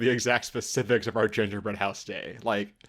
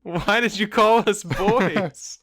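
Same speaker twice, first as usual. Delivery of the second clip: mock indignant; 9 semitones higher, 5 dB louder, much slower than usual. Mock indignant reply to Santa.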